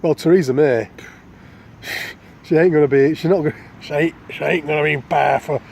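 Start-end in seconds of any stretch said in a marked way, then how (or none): 2.4-5.6 a light slip into Cockney; slightly thicker London Cockney, lightly done; Cockney accent. Shown as a demonstration. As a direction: let a mild Cockney accent creep in. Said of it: "God, I call that Cockney".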